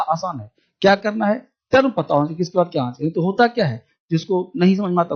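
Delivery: ordinary talk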